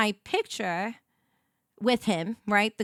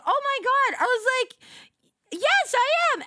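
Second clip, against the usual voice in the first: high, thrilled yes; high excited squeal; 14 semitones higher, 4 dB louder than usual. Retold or imitated. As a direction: squeal it like a delighted fan. Excited fan moment retold.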